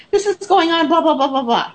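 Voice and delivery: high, urgent mock client voice; imitating agitated caller. Client's frantic late-night call acted out.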